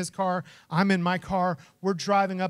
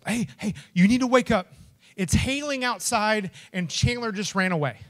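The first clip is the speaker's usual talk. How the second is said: retold urgent wake-up, higher and louder; urgent waking call; 4 semitones higher, 6 dB louder than usual. Roommate waking his friend over the runaway dog, urgent. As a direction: roommate breaking alarming news.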